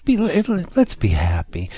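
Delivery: usual speaking voice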